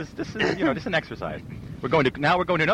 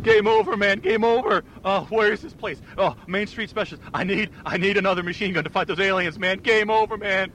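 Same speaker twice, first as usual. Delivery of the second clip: Hudson from Aliens; 6 semitones higher than usual. Acted. A panicked space marine crying out in the specialty store. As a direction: shout it like a soldier losing it. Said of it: "Hudson from Aliens".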